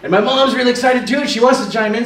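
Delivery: normal talking voice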